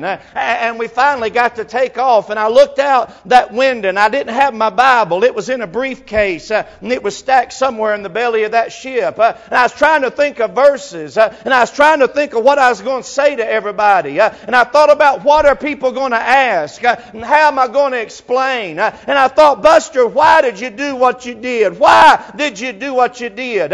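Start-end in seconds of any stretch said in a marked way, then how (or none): 2.3-19.6 loud, high, ringing preacher's cadence; full-force preaching. Acted. 19.6-23.7 loud, high cry of grief at a lost brother; grieving brother crying out why; anguished cry. Retold or imitated. Anguished question to dead brother.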